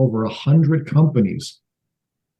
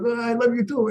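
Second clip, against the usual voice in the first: higher, softer imitation of an uncomfortable father; lightly imitated reluctant father, higher and softer; awkward dad; 10 semitones higher, 5 dB quieter than usual. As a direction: mimic dad mumbling it back awkwardly. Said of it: "They go".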